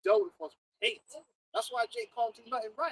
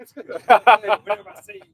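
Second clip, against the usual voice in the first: loud, slow mock demand through laughter; laughing echo; 17 dB louder, much slower than usual. Echoes the co-host's joke about the hater, laughing echo. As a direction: crack up while echoing the joke.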